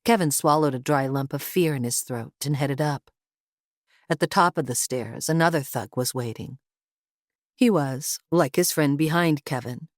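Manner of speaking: usual speaking voice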